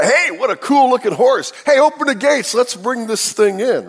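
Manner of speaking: high, eager mock Trojan cheer. Pastor mocking the Trojans opening their gates, high and eager. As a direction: play the naive Trojans welcoming the horse.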